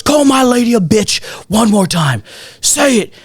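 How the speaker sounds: high-pitched imitation of a furious boyfriend; acted-out angry challenge; mock threatening yell. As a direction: dare the other man, voice climbing. Boyfriend threatens in retold fight.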